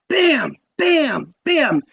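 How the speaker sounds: bang out each slap; imitated bangs. Mimicking hand slaps on window.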